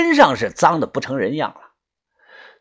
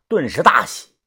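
ordinary delivery

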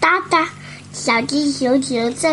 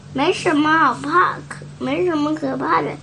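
plain reading voice

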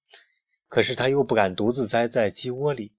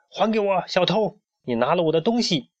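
high, indignant storybook demand; angry accusation; 8 semitones higher than usual. A narrator's reading. Pharaoh demands his treasure from the hen, angry. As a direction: accuse the thief, voice pitched up.

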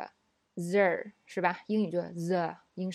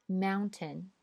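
British mountain with the t said; British English; 3 dB quieter than usual. Shown as a demonstration. Teacher reads mountain in a British accent. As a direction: mountain with a clear t, British style. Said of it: "我先读英音".

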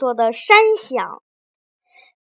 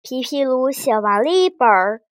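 plain reading voice

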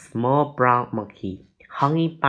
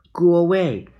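loud, higher storybook shout; angry shout; 5 semitones higher, 7 dB louder than usual. A narrator's reading. Annie to the screeching monkey, shouting. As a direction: shout to drive it off. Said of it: "Annie shouted".

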